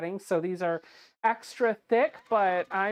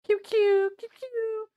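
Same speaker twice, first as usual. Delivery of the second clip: squeaky voice; 14 semitones higher, slower than usual. Acted. Squeaky nickname.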